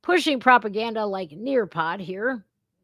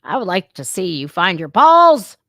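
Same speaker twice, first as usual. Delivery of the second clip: louder rant; 8 dB louder than usual. Real, not acted. Angry rant at superintendent.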